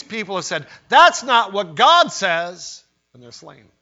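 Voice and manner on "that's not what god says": bursts out loud and slow; a slow, loud cry of defiance; loud defiant quote. Acted. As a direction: hurl it back as the martyrs' defiance.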